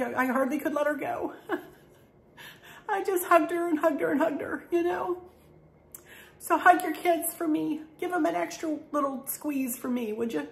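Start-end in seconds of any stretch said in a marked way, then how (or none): 0.0-8.2 high, teary laughter breaking into the words; laughing through tears. Real, not acted. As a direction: laugh while almost crying over a dear friend. Tearful laugh hugging Candace.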